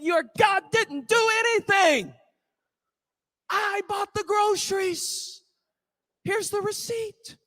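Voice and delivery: high-pitched exasperated protest, voicing the neighbour; mad atheist. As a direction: protest it high, at the end of your rope. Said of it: "The atheist got so mad".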